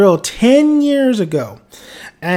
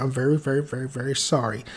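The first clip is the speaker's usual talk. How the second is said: soft, much quieter embarrassed sorry; sheepish apology; 5 semitones lower, 11 dB quieter, faster than usual. Real, not acted. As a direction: apologize softly, a little embarrassed. Sheepish apology for mispronouncing names.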